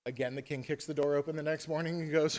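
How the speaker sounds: ordinary delivery, nothing marked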